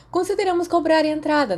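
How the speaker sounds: 1 gleeful tease. A narrator's reading. Sirius teasing about the party.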